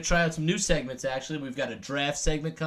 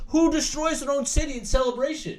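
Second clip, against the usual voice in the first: incredulous; 10 semitones higher, 3 dB louder than usual. Real, not acted. Ranting about Philly fans.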